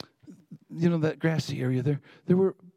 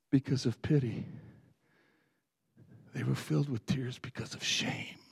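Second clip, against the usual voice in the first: lower, subdued voice with long pauses; hushed confession; 3 semitones lower, 4 dB quieter than usual. Real, not acted. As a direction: confess it softly, letting it sink in. Preacher confesses his tearful shame.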